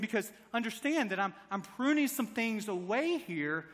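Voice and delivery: ordinary talk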